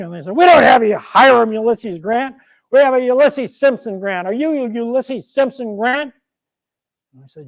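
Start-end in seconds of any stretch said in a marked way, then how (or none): 0.4-6.1 loud, raised-pitch official challenge; brusque army official. Acted. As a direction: correct the new cadet loudly. Academy officials challenge the new cadet.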